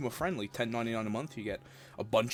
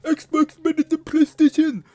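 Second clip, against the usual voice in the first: shrieking much higher and louder; fanboy spurg out; 15 dB louder than usual. Retold or imitated. Mocking a fanboy freaking out over consoles, shrieking. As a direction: scream it like a raging eight-year-old. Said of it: "have a fanboy spurg out and go".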